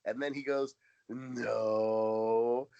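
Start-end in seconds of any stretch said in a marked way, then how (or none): 1.1-2.7 deadpan no. Retold or imitated. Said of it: "it was exactly the same tone".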